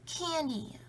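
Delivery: high delighted gasp of a word; thrilled discovery; excited. A narrator's reading. Mouse finds candy sack.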